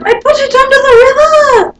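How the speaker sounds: very high loud squawk; high loud leprechaun squawk. Acted. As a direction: squawk it like a cornered bird.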